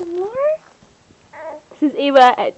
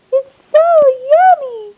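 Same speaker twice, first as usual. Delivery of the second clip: high, louder sing-song praise; 10 semitones higher, 10 dB louder than usual. Real, not acted. High loud praise to baby.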